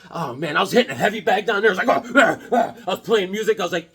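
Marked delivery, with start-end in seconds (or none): 1.9-2.7 high, loud punching grunts; effort grunts. Pretend. Unborn baby hitting a heavy bag, mock effort grunts. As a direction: grunt with each blow.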